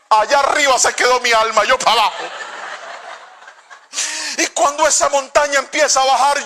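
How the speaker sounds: normal talking voice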